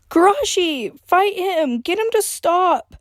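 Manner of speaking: high urgent shout. Acted. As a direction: plead at the top of her voice. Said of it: "Freya shouted".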